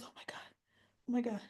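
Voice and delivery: breathless, hushed oh my God; mild mock horror; horrified gasp. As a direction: panic rising under your breath. Willow horrified, kids missing.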